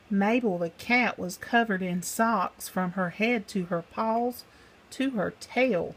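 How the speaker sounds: plain reading voice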